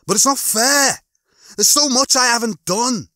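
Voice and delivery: high, loud, plaintive protest; Lister. Acted. Lister protests to Rimmer about dying, plaintive. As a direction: protest loudly at the unfairness, pitched right up.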